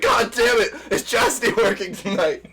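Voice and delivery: retold loud outburst. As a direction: imitate a friend yelling into the phone. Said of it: "as loud as he can".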